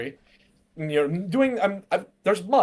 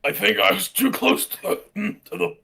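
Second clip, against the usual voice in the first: disguised voice; 3 semitones higher than usual. Acted. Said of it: "again, trying to keep that".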